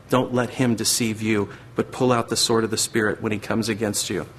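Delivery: usual speaking voice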